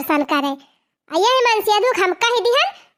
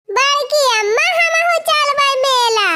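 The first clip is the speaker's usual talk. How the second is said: higher, lightly voiced eager child begging; imitating a child; 6 semitones higher than usual. Wife imitates a child pleading with grandma to go to the fair. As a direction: mimic a small kid pleading to go to the fair.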